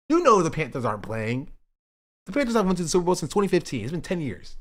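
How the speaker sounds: playful outraged complaint; mock-aggrieved rant. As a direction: protest as if wounded by the teasing. Mock-aggrieved at chatters' teasing.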